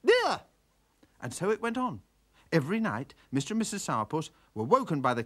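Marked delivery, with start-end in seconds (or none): 0.0-0.4 very high, loud squawk; squawking jay. Acted. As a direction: screech it in triumph.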